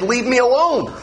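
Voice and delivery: a touch of mock fury; mock angry God. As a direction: play God fed up and snapping. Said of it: "He's so mad".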